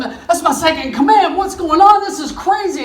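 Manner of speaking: can't believe his own man did this; shocked king. Acted. King reacts to Esther naming Haman, stunned.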